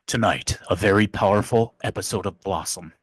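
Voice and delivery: low, dramatic promo voice; lightly voiced TV trailer announcer; imitating a TV promo announcer. Recalling an old TV promo, mild dramatic announcer voice. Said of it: "this is still in my head".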